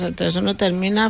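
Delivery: ordinary talk, nothing marked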